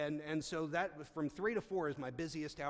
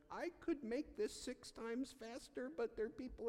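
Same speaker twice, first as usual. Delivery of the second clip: exasperated aside; 10 semitones higher than usual. Retold or imitated. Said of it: "I'll be like".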